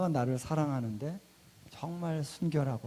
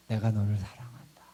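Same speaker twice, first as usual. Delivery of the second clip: hushed loving whisper; tender whisper; 4 semitones lower than usual. Retold or imitated. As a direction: breathe it close to the ear. Jesus voiced speaking love.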